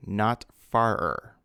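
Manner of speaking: slow, deliberate "farer", flagged as wrong; slow wrong form. Shown as a demonstration. Teacher correcting a common error, slowly. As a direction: stretch out "farer" like a caution. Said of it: "So far becomes farther".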